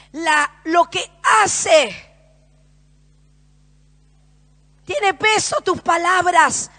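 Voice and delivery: loud, impassioned sermon delivery; fervent pulpit proclamation, driving every phrase; preaching at full force. Acted. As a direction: preach it out to the whole room. Preaching to the congregation.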